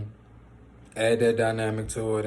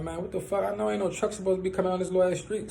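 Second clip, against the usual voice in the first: higher, fast, baffled exclaiming; alarmed disbelief; 8 semitones higher, faster than usual. Retold or imitated. On the porch, baffled by the shaking, alarmed disbelief. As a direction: retell the shock of the shaking house. Retelling confusion during the earthquake.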